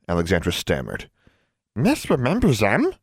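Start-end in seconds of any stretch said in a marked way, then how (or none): 1.7-2.9 put-on high, surprised question from an old elf; startled little query. A narrator's reading. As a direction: ask in pitched-up surprise. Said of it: "The elf sounded surprised".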